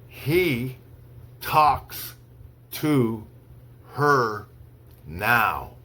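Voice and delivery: slow, one word at a time with long pauses; slow dictation. Shown as a demonstration. Teacher reads to students for dictation, slow, word by word. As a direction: read it word by word so they can write. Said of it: "I'll say each sentence slowly three times".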